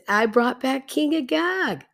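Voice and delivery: high-pitched mock indignation; defensive protest. Saul protesting, imitated.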